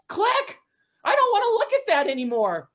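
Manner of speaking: high, loud, revolted; disgusted outburst. Acted. Owner revolted her friend's dog ate a rabbit, high and loud. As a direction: recoil and shout in disgust.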